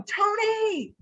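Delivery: high-pitched call-out, held back in volume; mock yell. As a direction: call across to someone in a high, restrained yell. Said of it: "I start yelling".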